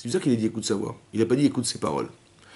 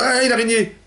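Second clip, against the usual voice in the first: high, alarmed cry about the spider, "ah" jumps up; startled exclamation; 11 semitones higher, faster than usual. Shown as a demonstration. Teacher acts out a girl startled by a spider, high and alarmed. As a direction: blurt "ah, il y a une araignée!" as a high startled cry. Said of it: "C'est bizarre, vous dites pas".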